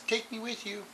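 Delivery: high, soft little voice for the bottle; talking bottle voice. Acted. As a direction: let the tiny bottle beg to come along. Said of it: "I don't know why I did it in that voice".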